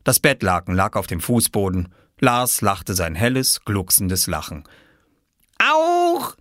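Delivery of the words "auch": put-on very high toddler squeal; delighted shriek. Lars tugging at Oskar's sheet, delighted shriek. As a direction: squeal with sheer excitement. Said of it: "rief er und rüttelte".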